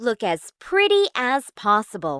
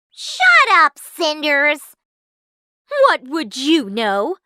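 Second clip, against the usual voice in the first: high, loud stepsister outburst; shrieked put-down easing into scorn; angry snap; 6 semitones higher, 6 dB louder than usual. A narrator's reading. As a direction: shout her down rudely. Stepsister snaps at Cinderella.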